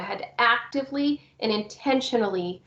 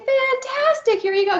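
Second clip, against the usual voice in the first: very high, loud delighted praise; excited praise; 4 dB louder than usual. Shown as a demonstration. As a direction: gush over the child's request. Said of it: "And I'm like".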